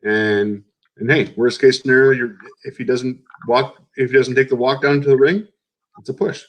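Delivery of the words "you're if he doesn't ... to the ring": ordinary talk, nothing marked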